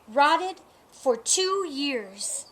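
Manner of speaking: slow, savoring each word; slow relish. Acted. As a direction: draw it out lovingly. Seller praises rotten eggs.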